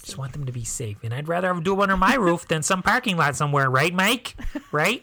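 folksy mock-Midwest mother; Midwest mom accent. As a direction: play a Midwest mom seeking agreement. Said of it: "my most favorite American accent".

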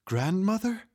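high, rising, disbelieving question; tentative little-girl question; child asking. A narrator's reading. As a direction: let it climb in wonder. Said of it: "Claudia asked".

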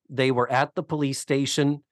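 usual speaking voice

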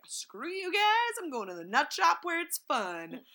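high, slow dismissive brush-off; lightly voiced carefree prince. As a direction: toss it off like a rebel royal. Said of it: "he's just like".

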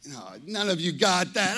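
ordinary talk, nothing marked

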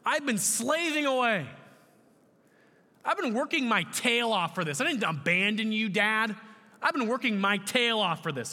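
high, loud indignant protest; aggrieved older brother. Acted. Older brother protesting to Dad about his hard work, aggrieved. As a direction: insist you have earned better.

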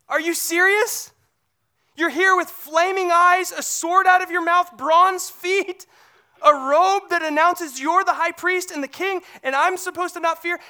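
are you kidding me, pushed out; mock disbelief at the vision; incredulous. Incredulous at Jesus' terrifying image.

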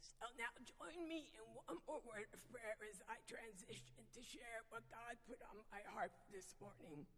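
ordinary talk

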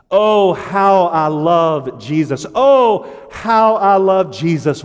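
fervent hymn declamation. Real, not acted. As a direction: stretch each "Oh" high and loud. Declaims hymn chorus with fervor.

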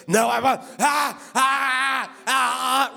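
crying out like someone manifesting a demon; mock possessed refusal breaking into cries; imitated demon possession. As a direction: act out full-blown demonic wailing. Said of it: "she started manifesting a demon, full blown".

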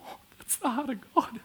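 high, tearful awe; overcome, voice lifting high and soft; on the verge of tears; choked with emotion. Real, not acted. Overcome with emotion mid-sermon.

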